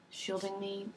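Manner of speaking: usual speaking voice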